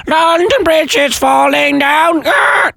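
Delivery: very high, drawn-out parrot screech; parrot reciting. Acted. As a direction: screech the rhyme like a parrot, slowly. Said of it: "So a law-breaking parrot there".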